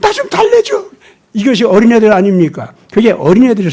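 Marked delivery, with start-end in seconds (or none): 0.0-0.9 put-on high, whiny child plea; whining child. Child begging parent to soothe them, whiny. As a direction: beg like a little kid. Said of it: "그럼 나보고 어떡하란 말이야?".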